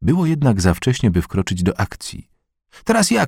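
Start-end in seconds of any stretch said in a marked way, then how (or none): 2.8-3.3 wysoki okrzyk, bez dużej głośności; zawołaj wysoko, jak dziecko domagające się kolejki; okrzyk chłopca. A narrator's reading. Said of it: "krzyknął Kuba". (lekki, wysoki okrzyk)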